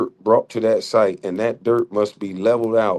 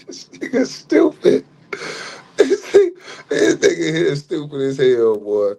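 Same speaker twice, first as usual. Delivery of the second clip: laughing; 5 semitones higher than usual. Real, not acted. Laughing at opponent's remark.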